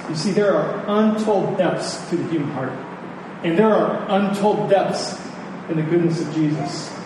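ordinary talk, nothing marked

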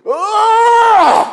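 sudden very high, loud wordless cry; anguished cry. Retold or imitated. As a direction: burst out in one long pleading cry. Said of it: "is Paul going".

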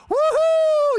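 very high, loud woohoo; dog bursting outside, high and loud; dog's excited whoop. Pretend. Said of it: "shot of adrenaline, like".

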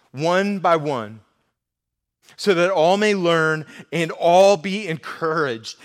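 slow, loud, measured reading of scripture. Acted. Pastor reading Paul's letter to the church, slow and loud. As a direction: read it out slowly and firmly.

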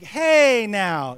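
playful "whoa there"; mock scandalised. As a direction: react to a racy remark. Mock shocked reaction to frisky remark.

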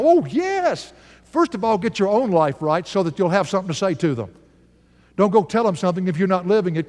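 ordinary talk, nothing marked

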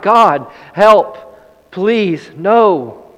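high, loud desperate cry; crying out to God. Retold or imitated. Burglar shaking the barred door, crying out to God. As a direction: call out to God in panic. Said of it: "He cried".

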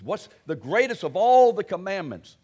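a loud retold question, with the stress on all. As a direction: boom it out like the asker in the crowd. Someone asked Jesus a question.

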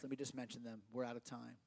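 usual speaking voice

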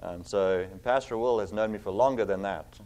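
usual speaking voice

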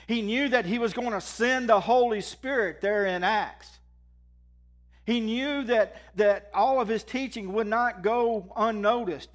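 loud, high, driving pulpit preaching; full-force preaching. Acted. Lift the room with each line. Preaching about what Jesus knew.

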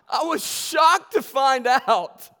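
shocked exclaim. Real, not acted. Preacher recalling his shock.

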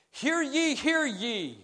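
calling out like a town crier. Retold or imitated. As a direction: hear ye announced as a street herald. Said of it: "The town crier".

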